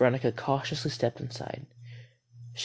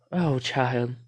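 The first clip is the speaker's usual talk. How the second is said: usual speaking voice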